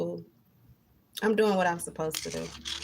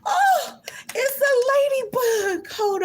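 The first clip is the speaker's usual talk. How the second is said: high, squealing surprise; suddenly spot something delightful; surprised delight; 4 dB louder, slower than usual. Real, not acted. Spotting a ladybug mid-rant, high surprised squeal.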